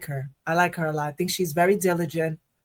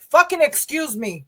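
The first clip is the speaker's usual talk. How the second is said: imitated indignant man; 6 semitones higher than usual. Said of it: "it was kinda like".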